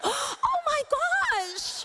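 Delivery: stunned viewer at a plot twist; shocked gasp. Retold or imitated. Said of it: "you're like".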